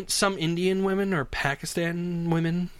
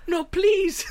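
high-pitched pleading squeal; mock horror; 14 semitones higher than usual. Frightened passenger refusing the photo, high pleading. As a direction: imitate a frightened lady begging. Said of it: "it was one of sheer horror".

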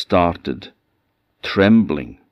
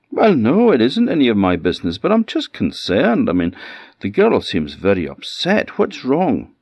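concerned pleading; 6 semitones higher, 3 dB louder than usual. A narrator's reading. Young man pleads, concerned.

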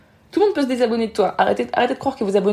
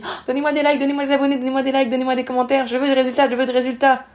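higher-pitched, chanting list of demands; mock begging; 4 semitones higher than usual. Coach mimicking creators begging followers for likes, mock begging. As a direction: parody a needy creator pleading on one insistent note.

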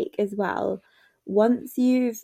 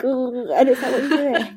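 stammering awkward suitor; 5 semitones higher than usual. Retold or imitated. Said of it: "this awkward voice on".

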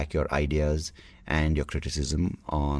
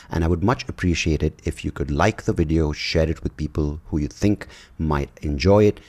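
louder, upbeat sign-off pitch; podcast host wrapping up with a louder call to subscribe; outro promo read; 6 dB louder than usual. Acted. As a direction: sell the subscribe ask to listeners. Reads outro promo for channel.

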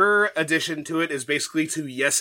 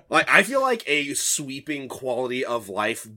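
normal talking voice

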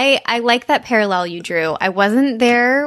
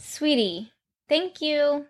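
soft praise to dog; 6 semitones higher, 7 dB quieter, slower than usual. Real, not acted.

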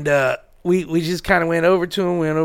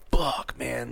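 stunned quiet; 5 semitones lower, 11 dB quieter than usual. Real, not acted. Stunned reaction to the sad story.

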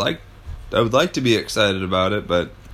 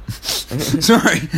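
laughing apology; 5 semitones higher, 3 dB louder than usual. Real, not acted. Friend reading band list laughs an apology. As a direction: laugh it out, then sorry.

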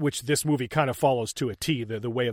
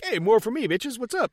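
mock party guy; 8 semitones higher than usual. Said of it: "Frank is like".

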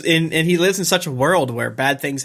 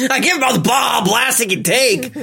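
mock shouted boast; 10 semitones higher, 4 dB louder than usual.

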